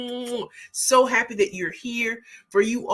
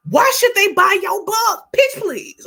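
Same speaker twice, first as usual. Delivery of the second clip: excited; 11 semitones higher, 9 dB louder than usual. Real, not acted. Hyping her pitching catchphrase.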